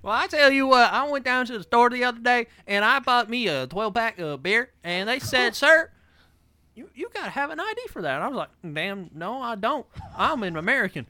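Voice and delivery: put-on lightly done Southern good-ol'-boy voice; Larry the Cable Guy. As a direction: spin a tall tale like a hillbilly comic. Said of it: "Larry the Cable Guy".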